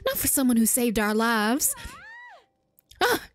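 hammed-up southern villager; grateful country folk in a put-on southern accent. Villager thanks rescuer and asks about shield surfing, southern accent. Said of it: "I started doing a southern accent".

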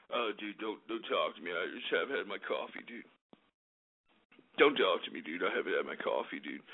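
very low, quiet morning grumble; mock a sluggish dude before caffeine; mock coffee grump. Host mocks people without their morning coffee, low grumpy dude.

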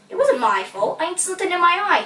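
quick protest, rising high; defensive dad pleading innocence. A narrator's reading. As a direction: rush out an excuse, pitch climbing. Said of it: "he protested".

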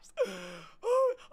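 sharp high-pitched intake of breath; squeaky recovering gasp. Real, not acted. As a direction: gasp for air after laughing. Catching breath after laughing.

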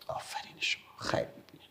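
very soft, whispery well done; barely voiced, quiet thanks; hushed praise. Real, not acted. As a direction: praise the pupils under the breath. Murmured praise after the recitation.

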